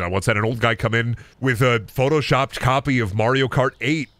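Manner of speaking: ordinary delivery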